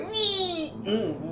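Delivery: high squeaky whimpering rat. Retold or imitated. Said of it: "sound like a rat".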